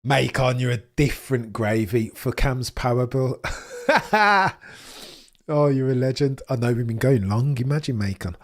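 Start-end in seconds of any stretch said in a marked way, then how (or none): none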